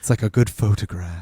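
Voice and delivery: snooty, self-important tone. Acted. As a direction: say it like a pretentious critic. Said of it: "I said that in a snooty voice".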